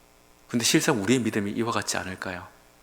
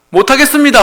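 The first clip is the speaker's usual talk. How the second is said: loud, higher whining excuse; pleading excuse; 13 semitones higher, 13 dB louder than usual. Retold or imitated. Moses whines to God, loud and high. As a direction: complain that you can't. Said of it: "할 때".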